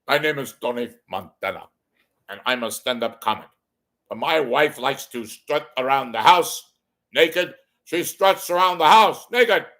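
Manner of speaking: put-on Tony Montana accent, rising to loud and high by the end; Scarface impression. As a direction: play Scarface doing stand-up. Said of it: "What if Scarface was a stand-up comedian?".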